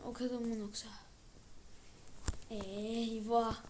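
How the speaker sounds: ordinary delivery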